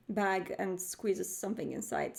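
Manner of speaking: ordinary talk, nothing marked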